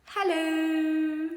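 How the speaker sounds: drawn-out high, loud hello from the puppy; a dog surprising everyone with a word; puppy saying hello. Acted. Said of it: "And George went".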